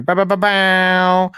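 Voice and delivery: a playful hammed-up sting; mock jingle. Host introduces the rapid fire segment with a playful sung sting.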